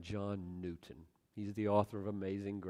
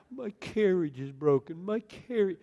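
higher, louder hammed-up whine; mock blubbering; 9 semitones higher, 9 dB louder than usual. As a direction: bewail it like a foolish crybaby. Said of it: "wringing his hands and blubbering".

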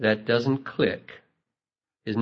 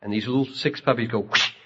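usual speaking voice

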